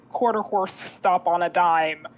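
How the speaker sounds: ordinary talk, nothing marked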